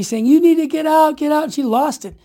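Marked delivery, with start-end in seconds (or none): 0.3-1.4 imitating a yell, higher and louder; mock yell of an angry booth lady; retold screaming. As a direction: throw her out with a raised voice. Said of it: "starts screaming at me".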